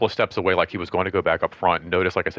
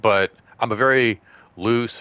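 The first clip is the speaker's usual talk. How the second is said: ordinary delivery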